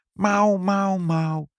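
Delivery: high, drawn-out meows; cat meowing. Acted. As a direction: coax the cat in its own language. Mother meowing to coax the cat. (hoog, vleiend gemiauw)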